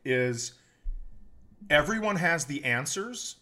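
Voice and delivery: ordinary delivery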